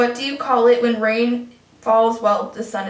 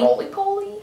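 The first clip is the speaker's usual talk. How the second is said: much higher teasing "Roly-poly"; mock echo; 9 semitones higher than usual. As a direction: toss it back up high. Echoing friend's answer.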